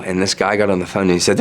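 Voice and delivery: ordinary delivery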